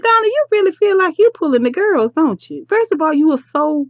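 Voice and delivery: high, incredulous telling-off; mock scolding. Vlogger ribs Donna about pulling the girls, mock scolding.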